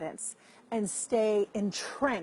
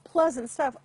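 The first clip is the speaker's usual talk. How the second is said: usual speaking voice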